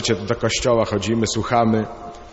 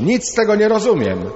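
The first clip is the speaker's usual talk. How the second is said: much higher, louder desperate cry; a boy shouting louder still in the Torah class; shouting even louder; 10 semitones higher, 4 dB louder than usual. Retold or imitated. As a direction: push it up past the last outburst. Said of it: "ale głośniej jeszcze".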